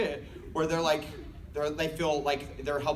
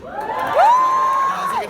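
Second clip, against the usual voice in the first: sudden shout; 9 dB louder than usual. Acted. Sudden shrill outburst in story.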